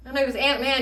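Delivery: ordinary delivery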